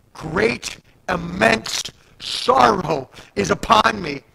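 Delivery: slow and loud, drawn-out cry of sorrow; a loud, slow preacher's lament; anguished lament. Retold or imitated. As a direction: stretch every word like a man undone. Isaiah's lament before the Lord.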